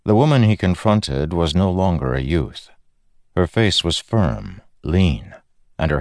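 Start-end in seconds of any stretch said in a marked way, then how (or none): none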